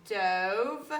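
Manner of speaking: dove with a drawn-out long O, oh. Shown as a demonstration. Teacher modelling the vowel for pupils, long O in dove. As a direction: stretch the O in dove. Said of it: "with a long O".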